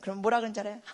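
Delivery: normal talking voice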